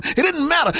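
preacher refrain; climactic refrain. Real, not acted. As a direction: drive home It didn't matter. Refrain peaks in pitch.

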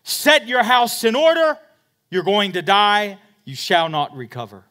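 loud, high proclaiming of doom; preaching at full force. Acted. Preacher booming Isaiah's verdict to the king. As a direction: thunder the prophet's verdict.

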